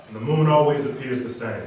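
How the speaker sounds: ordinary talk, nothing marked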